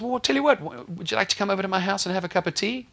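high, eager voice of the deacon; friendly deacon. Retold or imitated. Deacon invites the visitor home, high and eager. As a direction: offer the tea brightly, pitched high.

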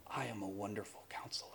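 very soft, low, gentle assurance; hushed Jesus quote. Acted. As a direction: drop low and quiet, as a counselor would. Preacher voices Jesus gently counseling.

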